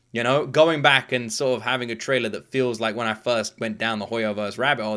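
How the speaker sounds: usual speaking voice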